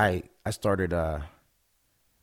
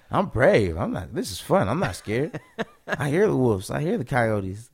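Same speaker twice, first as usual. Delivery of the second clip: put-on high, raised little-boy voice, swooping up and down; imitated kid yelling; 6 semitones higher, 5 dB louder than usual. Young son lost in dark woods, imitated yelling back. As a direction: mimic a child loudly showing off his bravery. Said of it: "yelling back at us like that".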